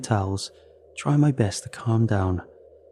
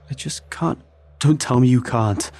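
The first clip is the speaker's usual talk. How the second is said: desperate outburst; 4 semitones higher, 4 dB louder than usual. A narrator's reading. Gunman breaks, shouts.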